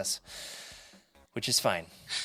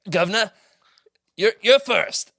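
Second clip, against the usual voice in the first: hammed-up British, higher and louder; jokey British accent attempt; mock British accent; 7 semitones higher, 5 dB louder than usual. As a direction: put on a loud, high-pitched British caller. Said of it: "Can we play it in British accents".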